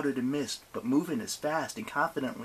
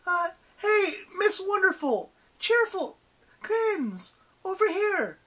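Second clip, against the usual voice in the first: high, loud distracting calls; high-pitched, taunting holler; calling out; 5 dB louder, slower than usual. A narrator's reading. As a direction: shout to draw the monster over. Annabeth calls to distract the goddess.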